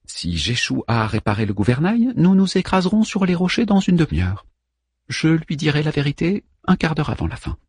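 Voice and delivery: high, restrained figure voice; Manmut's private thought. A narrator's reading. Manmut plans when to tell the truth, high inner voice. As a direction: pitch it up for the little navigator.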